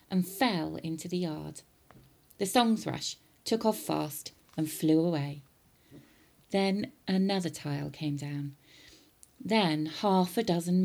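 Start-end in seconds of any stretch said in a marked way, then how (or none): none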